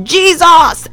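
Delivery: high-pitched startled cry, mock shout; retold shout. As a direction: re-enact shouting out of sleep. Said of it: "and I shout".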